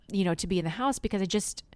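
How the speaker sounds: ordinary talk, nothing marked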